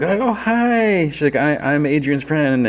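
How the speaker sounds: high, drawn-out, sing-song hello; gushing mock greeting. Friend greeting Adrian's mom, high and gushing.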